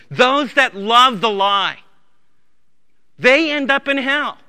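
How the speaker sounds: loud, high, urgent pulpit voice; fiery sermon climax; full-force preaching. Acted. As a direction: thunder the warning at the congregation.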